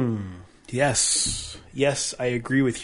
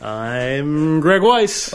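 slow booming host intro; game show announcer; 3 semitones higher, 5 dB louder, much slower than usual. Acted. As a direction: announce your name like a TV host. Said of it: "you sound like a game show announcer".

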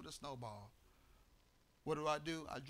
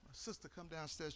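ordinary delivery

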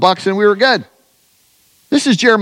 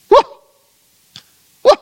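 short whoops; 6 dB louder than usual. Real, not acted. Preacher's exclamation after God's reply.

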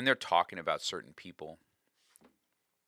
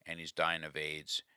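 ordinary talk, nothing marked

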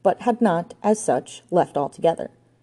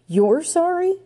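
very high, rising question of disbelief; incredulous echo; 13 semitones higher, slower than usual. A narrator's reading. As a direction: throw it back, pitched high in shock. Ranger appalled, going all pale.

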